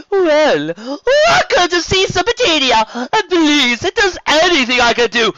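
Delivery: loud, high, slow and grand; flamboyant sea serpent. Acted. Serpent duke welcoming the dragon, effusive and loud. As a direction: proclaim with drawn-out, high-pitched flourish.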